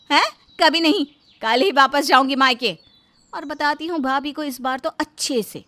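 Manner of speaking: lightly voiced offended wife; a touch of huffy defiance; indignant Rita. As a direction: argue back with rising indignation. Narrator acts Rita, indignant at husband.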